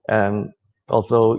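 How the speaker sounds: usual speaking voice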